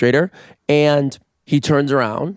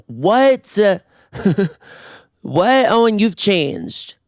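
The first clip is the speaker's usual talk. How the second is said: mock gasp; 7 semitones higher, much slower than usual. Mock audience gasping at news.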